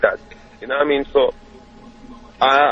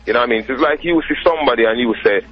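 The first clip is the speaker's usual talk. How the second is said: ordinary talk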